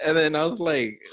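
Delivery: ordinary talk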